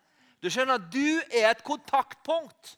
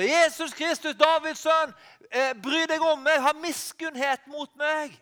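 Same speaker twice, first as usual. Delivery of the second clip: higher, louder desperate plea; crying out; 5 semitones higher, 4 dB louder than usual. Retold or imitated. Blind Bartimeus calling to Jesus, higher pleading cry. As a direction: call out to Jesus across the crowd. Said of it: "han kom og ropte".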